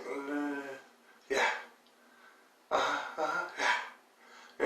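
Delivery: humoring a cousin, softly; dismissive mumble. Retold or imitated. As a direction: mumble along without listening. Imitating dismissive mumbled agreement.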